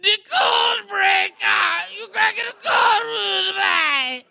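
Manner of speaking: big, high, stretched Jamaican yell; Jamaican shout. Retold or imitated. Host shouting the Jamaican man's line from the movie, high and loud. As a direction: belt it like a hyped Jamaican man. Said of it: "and it's a Jamaican man".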